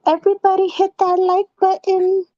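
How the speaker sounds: high, monotone imitation of streamers; mocking streamer voice. Woman showing babe how other channels beg for likes, high mocking voice. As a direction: put on a high, droning voice to mimic them. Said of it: "they say it in that voice, too".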